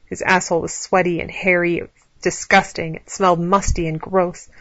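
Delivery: plain reading voice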